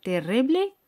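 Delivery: ordinary talk, nothing marked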